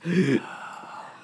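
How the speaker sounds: breathy whispered exhale; heavy breathy sigh. Real, not acted. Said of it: "excuse the heavy breathing".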